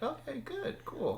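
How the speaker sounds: high, soft awkward reply; mock polite, unsure what to say; awkward okay. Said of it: "I was like".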